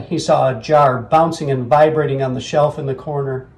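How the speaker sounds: ordinary delivery, nothing marked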